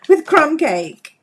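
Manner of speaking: giggling through the color name; laughing. Real, not acted. Crafter giggling at the funny stump colors. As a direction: laugh while naming it. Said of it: "I don't know why I'm laughing".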